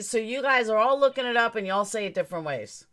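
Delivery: usual speaking voice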